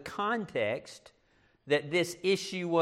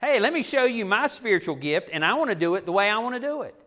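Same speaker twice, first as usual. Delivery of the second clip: higher, louder mock show-off; self-centered bragging; 5 semitones higher, 4 dB louder, faster than usual. Preacher mimics church members flaunting spiritual gifts, self-centered. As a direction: play a member eagerly pushing their own way.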